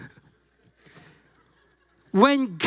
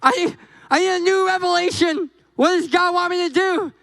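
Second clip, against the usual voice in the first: mock needy; 7 semitones higher than usual. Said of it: "We're like".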